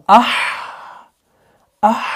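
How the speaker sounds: "आह" opening out into a very long airy h; weakening hams. Shown as a demonstration. Teacher taking the sound toward weakness, long breathed "आह". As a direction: exhale fully through the end of "आह". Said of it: "कमजोरी की तरफ ले जा रहा हूं".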